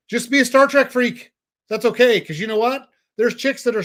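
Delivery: hyped-up coach pushing the listener on; excited urging. Real, not acted. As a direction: pump him up to just be himself.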